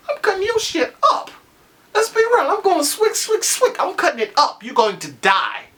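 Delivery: high, squealing mock threat; hyped-up revenge threat. Fan ranting at the screen, high squealing mock threat. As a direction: hype yourself into a pretend slashing. Imagined revenge rant on Ramsay.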